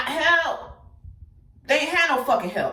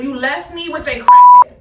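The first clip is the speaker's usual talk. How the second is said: much louder, blurting out the blame; sudden loud outburst; 4 semitones higher, 14 dB louder than usual. Real, not acted. Telling Aliyah off for leaving her with a crackhead, loud outburst. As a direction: throw the accusation out loud.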